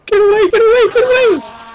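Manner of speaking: high screaming get away; panicked scream. Real, not acted. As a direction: shriek it in panic. Panicked at Enderman.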